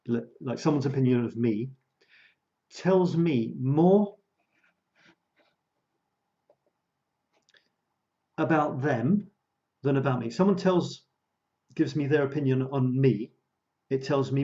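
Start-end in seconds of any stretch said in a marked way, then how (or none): 2.7-9.3 very slow, with long pauses; deliberate, higher-pitched, drawn-out restatement; said slowly. Shown as a demonstration. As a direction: stretch each word out for clarity. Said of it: "I'll say that slowly".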